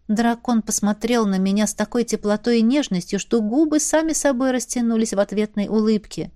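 ordinary delivery, nothing marked

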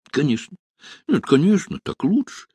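high-pitched, halting self-reassurance; murmured comfort to oneself, pitched high; self-consoling. A narrator's reading. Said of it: "как бы утешая себя, приговаривал Машин".

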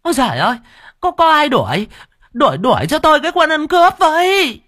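very high, loud panicked call; crying out for help. Acted. Noodle seller calling the crowd to catch the thief, very high and loud. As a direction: shriek for someone to chase him. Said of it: "mụ bán bún ốc la lên".

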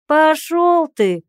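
sudden high, loud, slow yell; shouted. Acted. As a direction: spit it out as a shout. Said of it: "внезапно выкрикнул тот". (выкрик)

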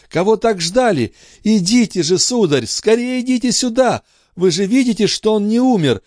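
very high, urgent pleading; mother begging the doctor to save her son, very high; desperate pleading. Acted. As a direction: urge the doctor on.

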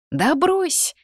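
high-pitched scoff of disbelief; incredulous brush-off; disbelieving. A narrator's reading. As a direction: toss it out high, not buying it. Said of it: "не поверила Дезра".

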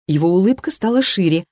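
plain reading voice

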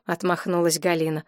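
ordinary delivery

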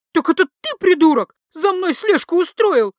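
enraged wife. A narrator's reading. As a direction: scream it at her husband. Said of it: "взбесилась женщина". (взбешённый крик)

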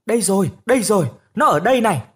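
loud, fast calling out; villagers shouting. Acted. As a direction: shout that the missing boy is found. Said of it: "tiếng người ta hô ầm ĩ".

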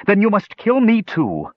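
high, defiant woman's voice; defiantly. A narrator's reading. Brandella defies Kishpa, high defiant voice. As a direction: dare him to do it. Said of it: "Brandella said defiantly".

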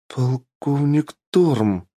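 put-on high, drawn-out amazed girl; gasped astonishment. A narrator's reading. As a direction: gasp it out slowly in amazement. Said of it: "ахнула девчонка". (изумлённо ахает)

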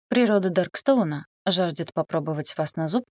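ordinary delivery

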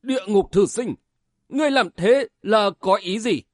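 mildly frightened protest; shaken, trembling question. A narrator's reading. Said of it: "run lên hỏi".

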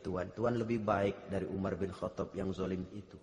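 hushed retelling. Retold or imitated. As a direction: tell it like a secret kindness, hushed. Quiet retelling of the meal scene. (penuturan lirih dan pelan)

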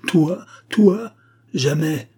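high, loud indignant outburst; outraged cry. A narrator's reading. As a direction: cry out in refusal. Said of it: "s'écria Jacob en serrant le poing".

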